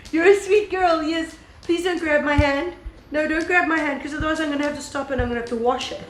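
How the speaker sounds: high pet-talk, half scolding. Real, not acted. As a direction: gently tell off a pup in a high coo. Talking to the dog grabbing her hand.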